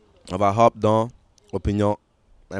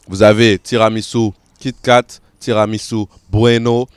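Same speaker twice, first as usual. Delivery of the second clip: ordinary talk, nothing marked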